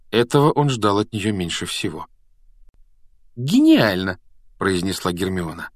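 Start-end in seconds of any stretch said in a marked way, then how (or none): 3.4-4.2 high, loud exclamation; delighted Hermione. A narrator's reading. Hermione exclaims over the riddle, delighted. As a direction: burst out with admiration.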